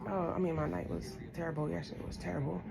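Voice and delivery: quiet, holding back tears; emotional, subdued. Real, not acted. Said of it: "I'm getting emotional again".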